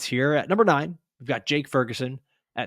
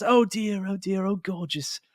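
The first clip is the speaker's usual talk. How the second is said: Gordon Ramsay gushing; 7 semitones higher, slower than usual. Retold or imitated. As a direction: coo gently like to a crying kid. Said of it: "it's the Gordon Ramsay thing".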